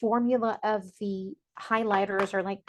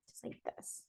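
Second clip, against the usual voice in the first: breathy whisper to yourself; 18 dB quieter than usual. Real, not acted. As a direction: barely voice it, just air. Whispered aside while drawing.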